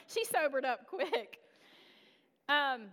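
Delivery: normal talking voice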